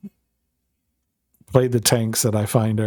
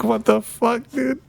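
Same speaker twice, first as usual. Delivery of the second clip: shocked disbelief; 12 semitones higher, slower than usual. Retold or imitated. Retold shocked reaction to rant.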